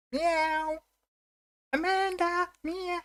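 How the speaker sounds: high squeaky meowing like a kitten; cat meow. Acted. Calling the cat Amanda with a high meow.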